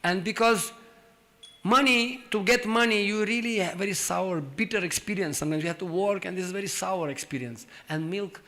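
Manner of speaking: usual speaking voice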